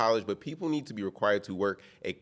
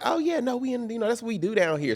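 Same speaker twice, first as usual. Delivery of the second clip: much higher, breezy waitress voice; a waitress cheerfully shrugging it off; Southern waitress; 10 semitones higher than usual. Retold or imitated. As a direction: toss it off lightly like a friendly waitress.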